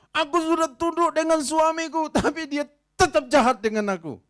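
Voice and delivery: high, loud bitter complaint; aggrieved loud lament. Acted. Preacher voicing a wife wronged by her husband, loud and hurt. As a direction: protest your wasted loyalty.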